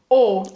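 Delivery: O said as a closed French "o"; a short, clear closed O; closed O vowel. Shown as a demonstration. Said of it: "como se fosse um".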